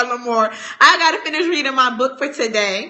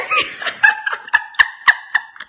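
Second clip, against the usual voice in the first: high breathy cackle; 4 dB louder than usual. Real, not acted. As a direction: burst into a cackle. Big laugh.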